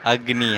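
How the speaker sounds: अग्नि with half ग joined straight into नी; half g blended. Shown as a demonstration. Teacher to child, अग्नि with a half ग. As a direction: say अग्नि as अग्-नि, no vowel after ग. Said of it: "क्योंकि आधा ग है और नी पूरा है".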